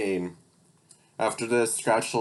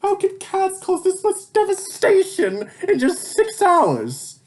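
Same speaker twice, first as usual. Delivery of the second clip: villain yelling; 6 dB louder than usual. Acted. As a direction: rant at the sky in disbelief. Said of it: "Then he yelled".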